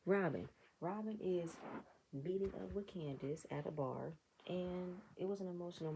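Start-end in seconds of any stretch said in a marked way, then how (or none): none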